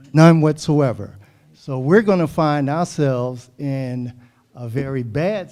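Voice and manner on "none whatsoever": emphatic. Real, not acted. Council member stressing no coverage.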